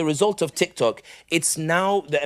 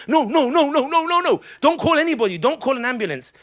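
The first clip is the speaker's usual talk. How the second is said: panicked pleading; 9 semitones higher than usual. Retold or imitated. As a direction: beg them not to call anyone, in a high panic. Friends panicking about calling doctor.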